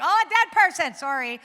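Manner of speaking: very high, loud comic yelp; mock startled exclamation. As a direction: blurt it out high, as if suddenly interrupted. Acts out interruption by the dead.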